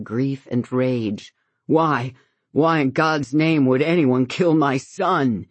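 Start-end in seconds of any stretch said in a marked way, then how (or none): 1.7-5.5 wild, accusing demand. A narrator's reading. Grieving father accusing everyone in the room. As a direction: lash out, accusing each of them. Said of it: "His voice is wild, accusing".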